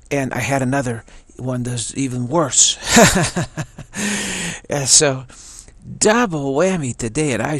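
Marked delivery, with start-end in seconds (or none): 2.8-4.6 rueful high, loud laugh. Real, not acted. Man recalling an even worse nightmare, laughing. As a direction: burst out laughing.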